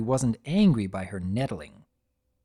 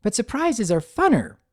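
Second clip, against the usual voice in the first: bubbly; 10 semitones higher, 4 dB louder than usual. A narrator's reading. Pinkie bubbly reply.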